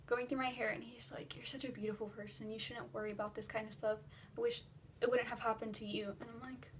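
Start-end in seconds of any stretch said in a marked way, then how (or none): 1.4-6.2 imitating a quiet consoler; retold comforting. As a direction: gently soothe someone who has been crying. Retold man comforts the crying teller.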